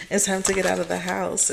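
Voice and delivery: usual speaking voice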